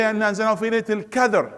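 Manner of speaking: Palestinian qaf as "k" in "القدر"; Palestinian Khalil accent. Shown as a demonstration. Teacher imitates a reciter from Palestine, Palestinian accent. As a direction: recite it quickly Hebron-style, Q fronted to K. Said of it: "somebody from Al-Khalil, from Palestine".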